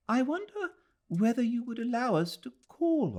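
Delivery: put-on a polite old lady, hopeful and careful; Mrs Moore's voice. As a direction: offer the visit tentatively and warmly.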